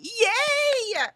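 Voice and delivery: high, loud, drawn-out cheer; excited cheer. Real, not acted. Host celebrating a viewer joining, excited cheer. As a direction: cheer them on brightly, way up high.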